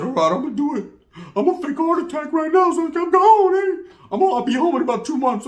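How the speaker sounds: high, loud, hammed-up dopey quote; mimicking the homeboy. Weak-minded homeboy telling his girl he'll drop out, mocked. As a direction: push it way up high, mocking him.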